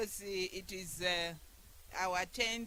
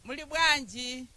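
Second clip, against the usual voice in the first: calling out louder; louder call to the crowd; 4 semitones higher, 8 dB louder than usual. Real, not acted.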